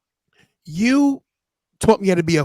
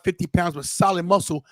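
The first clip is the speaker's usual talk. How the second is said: usual speaking voice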